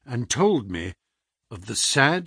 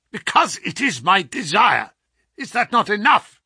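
higher, louder domineering; masterful; 8 semitones higher, 5 dB louder than usual. A narrator's reading. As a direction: brook no argument. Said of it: "more masterful than ever".

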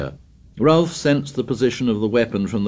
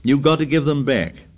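demanding; 6 semitones higher than usual. Acted. Ralph demanding.

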